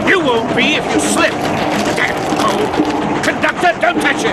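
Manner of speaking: shouted orders at the reckless man and conductor; angry shout. Acted. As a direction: bark the warnings. Shouting over train noise.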